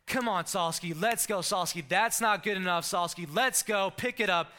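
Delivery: mock drill-sergeant coach yelling at practice. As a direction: yell it like an impatient coach on the court.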